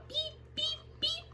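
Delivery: high beep beep like a truck horn; playful horn imitation. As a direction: toot the horn sounds high. Truck horn sound effect.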